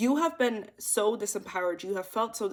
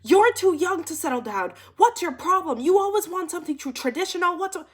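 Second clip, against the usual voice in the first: high, loud scolding imitation of a friend; mock freak-out; 9 semitones higher, 7 dB louder than usual. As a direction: mimic her losing it, rapid-fire accusations. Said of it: "She freaks out".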